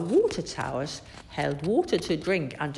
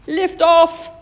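loud and high lift-off call; excited exclamation; 10 semitones higher, 11 dB louder than usual. A narrator's reading. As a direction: announce the launch. Narrator exclaims launch.

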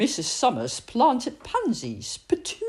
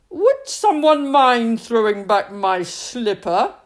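put-on louder, polite old man; voiced old man, sheepish; old man's voice; 4 semitones higher, 7 dB louder than usual.

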